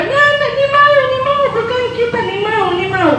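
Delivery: very high wailing plea; crying child. Acted. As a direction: sob it out, squirming. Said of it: "dia menangis".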